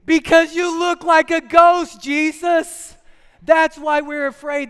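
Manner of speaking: high, loud, slow frightened yelling; panicked shout. Acted. Disciples shouting at Jesus in hammed-up fear. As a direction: yell back in a panic.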